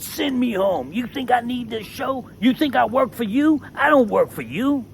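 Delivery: hollering back at the boss, voice high and heated; retold hollering. Retelling yelling at the producer, high angry holler. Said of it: "I started hollering".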